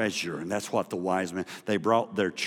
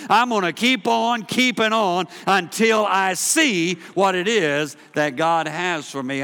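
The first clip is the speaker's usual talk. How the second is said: louder, driving sermon delivery; full-force preaching; 9 semitones higher, 8 dB louder than usual. Acted. Pastor rallying the congregation, full-force preaching. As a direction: preach it to the back row, loud and building.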